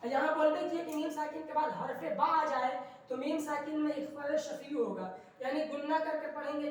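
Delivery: ordinary delivery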